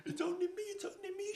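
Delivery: very high, tremulous little voice with a sob; a scared girl answering, close to tears; frightened girl. Acted. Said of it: "she said".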